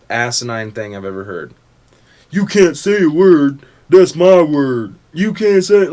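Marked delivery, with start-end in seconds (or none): 2.3-5.9 loud, high mocking voice; hammed-up outraged objection; mock word police. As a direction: imitate an offended scold, pushed loud. Mocking people who police words.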